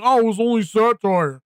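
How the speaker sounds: a lightly put-on whiny excuse voice; mocking them. Imitating the people who claimed satire, mild mock whine. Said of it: "and they're like".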